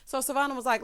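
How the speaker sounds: usual speaking voice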